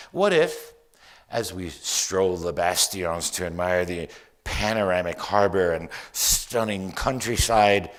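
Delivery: loud, emphatic poetry reading; poem recital. Acted. Poet dreaming up a whole new life in Malta, theatrical poem recital. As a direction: build each what-if into a rising, projected daydream. Reads own poem aloud.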